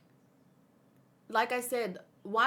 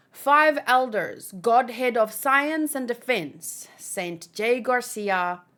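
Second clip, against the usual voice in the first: dramatic title read; 5 dB louder, slower than usual. Acted. Reads chapter title dramatically.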